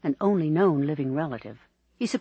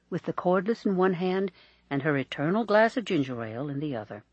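ordinary delivery, nothing marked

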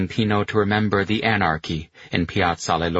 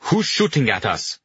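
raised-pitch, alarmed demand; 5 semitones higher than usual. A narrator's reading. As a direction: demand an answer from under fire. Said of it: "General Liers demanded".

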